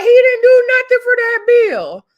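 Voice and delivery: high, loud exclamation of disbelief; outraged squawk at an undeserved boast; indignant retort. Retold or imitated. Indignant retort to Saucy's brag.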